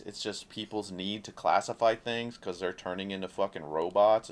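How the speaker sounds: normal talking voice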